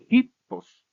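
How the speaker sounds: "hipos" with HI punched high and loud; stress on penultimate syllable. Shown as a demonstration. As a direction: hit the first syllable of "hipos" hard. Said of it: "Está el acento en la penúltima sílaba".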